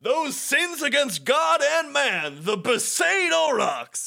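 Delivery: high, drawn-out stadium call; hammed-up sports announcer. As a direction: proclaim it like an outraged match commentator. Voicing the mocking match commentator.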